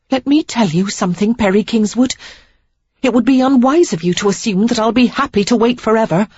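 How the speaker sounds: Sarah, warning. Acted. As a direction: put him on notice. Sarah's lines, higher voice.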